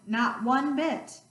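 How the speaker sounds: ordinary delivery, nothing marked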